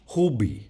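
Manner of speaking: "Khubi" opening on a throat-made kh; Farsi kh sound. Shown as a demonstration. Teacher showing a sound English lacks, Farsi pronunciation. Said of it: "they have this sound that we don't have in English".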